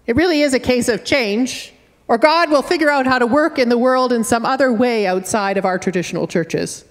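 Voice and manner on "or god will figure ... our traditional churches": ordinary talk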